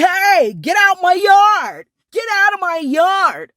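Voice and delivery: high, loud mock yell; imitating a yell. Owners yelling at her off the yard, loud and high.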